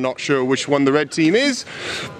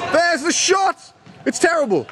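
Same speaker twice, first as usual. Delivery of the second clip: excited call; 11 semitones higher than usual. Acted. Commentator calling a shot.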